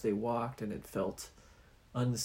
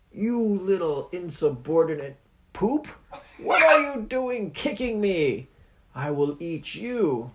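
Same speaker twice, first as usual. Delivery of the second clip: annoyed Oni; 6 semitones higher, 7 dB louder than usual. Acted.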